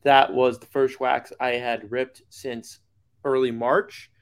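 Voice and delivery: ordinary talk, nothing marked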